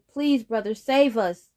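pleading. Acted. Siblings cry for help.

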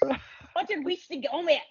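quiet echo of a mock Scottish mum, words run together. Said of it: "that's kind of like my Scottish kind of".

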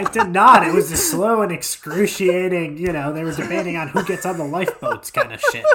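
loud, high-pitched, laughing objection; real laughing protest. Real, not acted. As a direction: protest loudly through laughter. Cracking up over Titanic joke.

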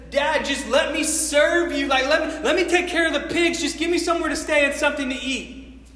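urgent, pushed-up begging; pleading. Acted. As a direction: beg your father to take you back. Returning son begs father to serve.

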